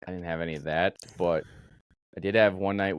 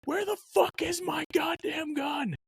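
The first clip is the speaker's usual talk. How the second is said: yelling sergeant; 3 dB quieter, slower than usual. Retold or imitated. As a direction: yell it like a furious sergeant waking the gun crew. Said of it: "Sergeant Harshman just yelling".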